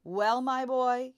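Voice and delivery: loud, slow and pompous; loud booming voice. A narrator's reading. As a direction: boom it out like a proud father. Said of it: "addressed his son in a loud voice".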